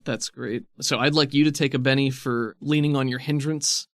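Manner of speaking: ordinary talk, nothing marked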